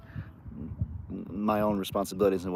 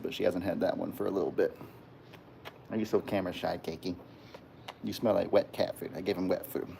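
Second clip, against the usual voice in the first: ordinary delivery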